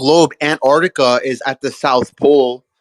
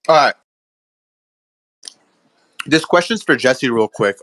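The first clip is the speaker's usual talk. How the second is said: usual speaking voice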